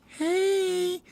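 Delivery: very high, soft squeaky hey; shy little bird answering; girl bird's hey. Acted. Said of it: "and she goes".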